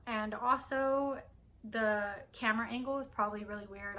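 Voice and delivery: ordinary talk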